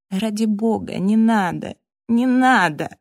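anguished, rising appeal; desperate pleading. A narrator's reading. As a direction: beg for mercy from the quarrel. Tormented man begs quarrellers to stop.

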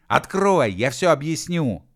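high, loud plea through a lid; urgent shout. A narrator's reading. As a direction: shout to be let in. Pounding on a lid, pleading.

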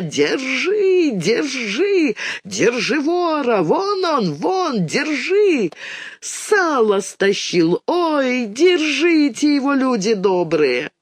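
shrill cries of stop thief; yelling high after a thief; high crowd shout. A narrator's reading. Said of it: "раздался визгливый крик".

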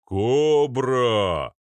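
long booming bellow; loud drawn-out giant's call; Arnold. A narrator's reading. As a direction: hoot it like a locomotive horn. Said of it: "Его бас разнёсся по коридору, как гудок локомотива".

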